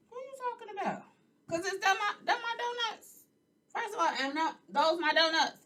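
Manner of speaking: high, mock-indignant "what are you talking about"; retold comeback. Host retelling her reply to the donut shop girl, high and indignant. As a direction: squeak it out like a confused customer. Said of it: "I said".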